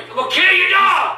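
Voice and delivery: furious shouted threat; real enraged shout; threatening yell. Real, not acted. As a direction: holler it in rage. Yelling threat at neighbour.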